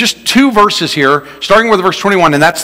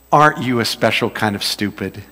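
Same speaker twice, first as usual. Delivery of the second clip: low, quiet deadpan; a dry dig, held back; mild dry sarcasm; 6 semitones lower, 7 dB quieter than usual. Shown as a demonstration. Preacher translates a Southern compliment with a dry dig. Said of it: "the real meaning of it is".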